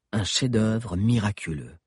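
usual speaking voice

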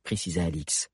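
ordinary delivery

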